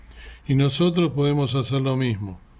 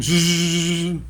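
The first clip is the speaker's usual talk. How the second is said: playful drill noise between questions; dentist drill buzz; 4 semitones higher than usual. Retold or imitated. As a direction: mimic the whirr of a dentist's drill. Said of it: "lo trato de hacer como lo hacía el hermano".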